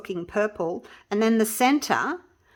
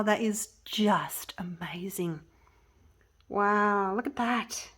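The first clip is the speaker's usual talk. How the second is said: slow, breathy whisper of wonder; awed whisper; slower than usual. Real, not acted. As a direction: hush it out as if in awe. Admiring the finished piece.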